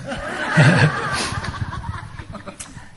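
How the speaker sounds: a long high burst of laughter; hearty laugh. Real, not acted. Speaker cracking up at the end of his story, hearty laugh. Audience-facing story punchline.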